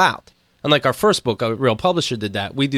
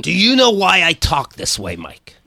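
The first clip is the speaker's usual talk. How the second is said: a bit higher, put-on Fierstein voice; Harvey Fierstein voice; 4 semitones higher than usual. Host asking Mike a question in a put-on Harvey Fierstein voice. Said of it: "that's Harvey Firestein now?".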